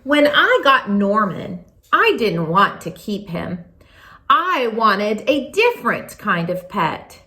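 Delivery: plain reading voice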